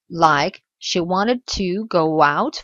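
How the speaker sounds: "she wanted to go out" slow, with go and out linked; very slow demo. Shown as a demonstration. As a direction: drag each word out for learners. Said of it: "liaison entre go et out".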